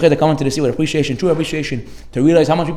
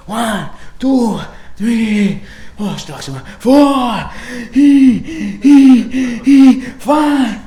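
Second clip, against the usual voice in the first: gasping louder and higher with each floor; panting climber; 6 semitones higher, 5 dB louder, much slower than usual. Retold or imitated. Climber on stairs counting floors, getting more and more breathless.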